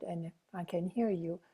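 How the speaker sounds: ordinary delivery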